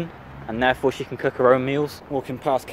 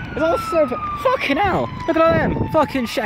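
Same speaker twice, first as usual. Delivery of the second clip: much higher, pitch leaping on each curse; stunned shock at the sirens; excited swearing. Real, not acted. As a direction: blurt it out as the scene unfolds. Sees fire engines, reacts.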